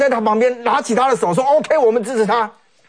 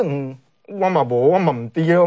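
Taiwanese-voiced Han Kuo-yu; 5 semitones lower than usual. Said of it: "韩国瑜的那个说法是".

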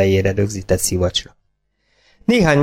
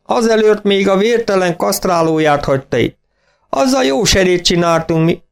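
much higher, gloating farmer voice; gleeful farmer; 10 semitones higher than usual. A narrator's reading. Farmer mocking Siegfried's forgetfulness, high and gleeful. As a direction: relish every word of the joke.

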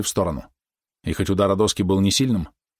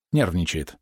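plain reading voice

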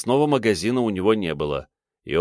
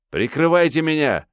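high-pitched desperate yell; 8 semitones higher, 3 dB louder than usual. A narrator's reading. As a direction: bellow for cover, pitched high. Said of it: "завопил сочинитель".

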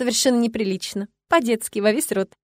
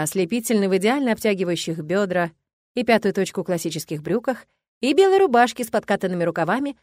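usual speaking voice

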